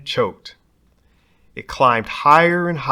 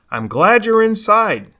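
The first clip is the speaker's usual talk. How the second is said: mama's voice; 8 semitones higher, 6 dB louder than usual. Acted. Mama greets Rodney at door.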